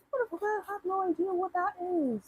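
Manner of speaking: high-pitched, soft disbelief; baffled. Real, not acted. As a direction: squeak it out high and softly, at a loss. Baffled at a viewer's question.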